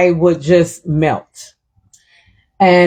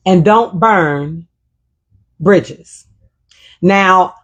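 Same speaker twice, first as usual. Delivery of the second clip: ordinary talk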